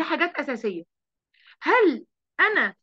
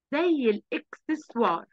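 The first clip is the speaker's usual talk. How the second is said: ordinary talk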